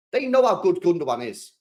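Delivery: normal talking voice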